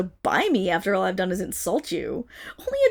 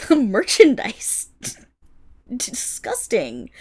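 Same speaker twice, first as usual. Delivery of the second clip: scornful laugh; 6 dB louder, slower than usual. Acted. Laughs scornfully at being merchandise.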